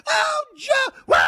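high, shrieking put-on laugh around "Oh, Joe!"; mock giggling cackle with a squealed "Oh, Joe!"; mocking giggly cackle. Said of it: "She cackles and giggles like a junior high kid".

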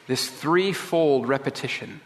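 usual speaking voice

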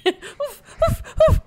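high, loud panting oofs; dog-like oof. Pretend. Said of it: "Why do I keep going "Oof," like a dog".

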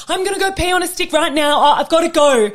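high, loud, breathless-with-news excitement; excited outburst. Retold or imitated. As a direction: re-enact bursting with urgency to go. Retelling reaction to pregnancy news.

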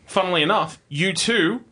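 usual speaking voice

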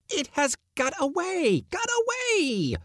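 extremely high, slowing wail of outrage; ferocious cry. A narrator's reading. Uncle crying out when the mouse escapes, very high. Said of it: "cried Pyotr Demyanich, looking ferocious".

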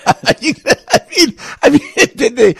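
laughing through the words; can't stop chuckling mid-sentence. Real, not acted. Host laughing about Putin's long history lectures, laughing.

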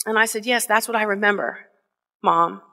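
ordinary talk

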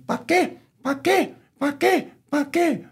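very high, short clucked questions; hen clucking. Acted. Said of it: "the hen went clucking".

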